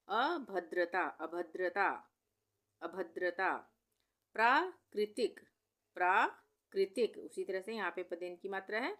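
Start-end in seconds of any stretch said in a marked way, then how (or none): 4.3-7.2 प्राकृतिक slow and loud on प्रा, then कृतिक; broken-up dictation. Shown as a demonstration. Teacher dictating a spelling word, प्राकृतिक in loud slow parts. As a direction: punch प्रा, pause, add कृतिक.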